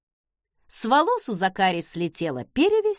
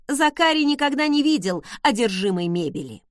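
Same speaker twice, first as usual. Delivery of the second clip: ordinary delivery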